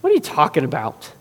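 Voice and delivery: scoffing, rising to loud disbelief; mocking. Hired mourners laugh at Jesus, mocking and dismissive. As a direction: puff dismissively, then argue it loudly. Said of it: "they mock Jesus for this".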